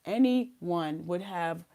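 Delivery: ordinary talk, nothing marked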